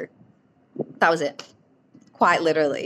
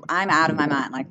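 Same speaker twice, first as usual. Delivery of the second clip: usual speaking voice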